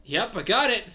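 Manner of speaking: pleased to have found the game; eager Chris. Acted. Chris tells Lucario he found the game, eager.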